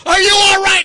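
high, loud urgent question; alarmed shout. Retold or imitated. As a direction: burst in shouting for your colleague. Alarmed colleagues burst in.

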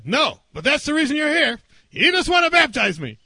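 high-pitched, indignant accusation; retold anger. Angry man accuses visiting pastor, indignant mock protest. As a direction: play the man who got mad. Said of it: "they get mad".

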